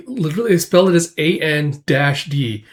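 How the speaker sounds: ordinary talk, nothing marked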